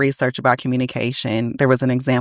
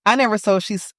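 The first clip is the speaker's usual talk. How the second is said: ordinary talk, nothing marked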